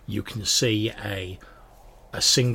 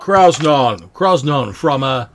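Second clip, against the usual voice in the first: loud, higher-pitched dwarf introduction; bellowing dwarf; 5 semitones higher, 11 dB louder than usual. Acted. Dwarf giving name and home to fellow dwarf, bellowing. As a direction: announce yourself in a booming voice. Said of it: "He's like".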